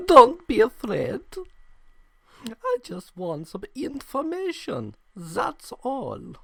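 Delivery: put-on menacing German officer accent, high and slow; German villain accent. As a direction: put on a thick German accent to soothe a scared kid. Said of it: "that's the accent we're going with".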